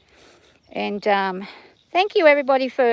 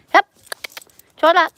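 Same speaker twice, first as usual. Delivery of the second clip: raised, louder call to the horse; called command; 7 semitones higher, 5 dB louder than usual. Real, not acted. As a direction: sing out the gait command. Calling commands to her horse.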